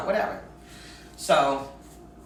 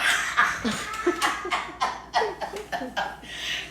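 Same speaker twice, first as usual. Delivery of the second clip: high breathy laugh; 14 semitones higher than usual. Real, not acted. Laughing at her stuttering dad, airy giggle.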